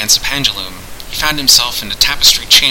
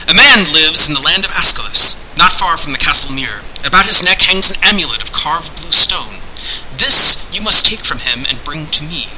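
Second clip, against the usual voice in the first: raised, declaiming voice of a hidden master; imperious sorcerer; 5 semitones higher than usual. Acted. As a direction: set out the terms with grand formality. Voices Pendulum giving the task.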